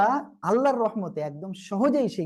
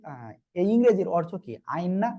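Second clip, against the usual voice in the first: normal talking voice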